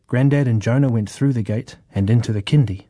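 ordinary delivery

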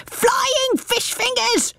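very high, slow squawk; parrot shriek. A narrator's reading. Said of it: "shrieked Rumbucket".

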